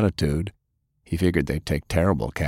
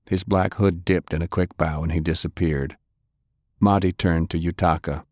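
plain reading voice

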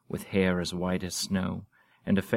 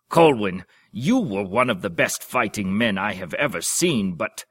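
loud bellow, then a little less; roaring voice; 2 semitones higher, 7 dB louder than usual. Acted. Old duke roaring at his fellow duke, loud. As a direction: keep roaring at the old comrade. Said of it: "in the same roaring voice".

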